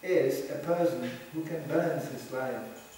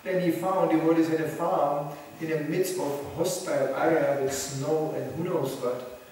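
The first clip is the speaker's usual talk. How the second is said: ordinary talk, nothing marked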